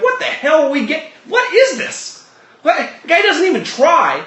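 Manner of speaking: high, exasperated whining fan; frustrated Laker fan. Retold or imitated. Host voices a fed-up Laker fan, high and exasperated. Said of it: "you're like".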